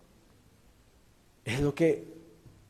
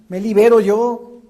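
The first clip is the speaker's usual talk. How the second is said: higher, louder ringing declaration; proclaiming; 4 semitones higher, 9 dB louder than usual. Real, not acted. Preacher proclaims to his congregation, loud and high. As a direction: call it out like a release.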